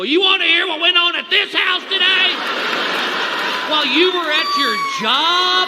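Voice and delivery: exasperated shout of a fed-up spouse; irate wife. Acted. Fed-up wife confronts husband after work.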